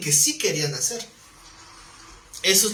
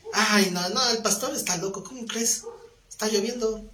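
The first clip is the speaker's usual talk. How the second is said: higher-pitched protest, played for laughs; reluctant brother; 5 semitones higher than usual. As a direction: imitate someone wriggling out of an invitation. Acted lazy brother making excuses.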